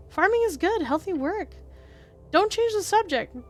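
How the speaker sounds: high-pitched protesting character voice; bickering inner voice. Acted. Inner voices bickering over farming.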